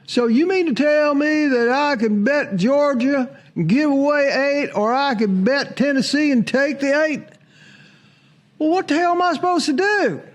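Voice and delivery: high, mock-exasperated voice; hammed-up disbelief climbing to a peak; baffled bettor. Imagined bettor weighing Georgia or Tennessee, mock-exasperated high voice.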